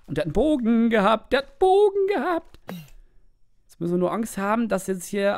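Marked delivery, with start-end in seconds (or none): 1.3-2.6 high outrage. Real, not acted.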